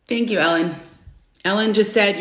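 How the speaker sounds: ordinary delivery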